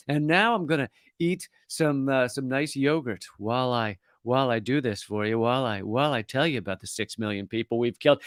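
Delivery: nice calm voice. Shown as a demonstration. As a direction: talk about horrors as if it were lovely. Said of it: "in a nice, calm voice".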